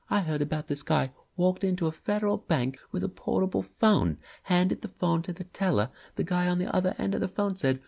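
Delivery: put-on young man pitching a bank robbery; young man ranting. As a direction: sell the bank story excitedly. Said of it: "slight working-class English accent".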